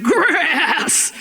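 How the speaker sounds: long, quavering goat-like cry; disgusted bleat. Acted. Cub refuses the hunk of meat, bleating in disgust. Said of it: "looked at him in disgust".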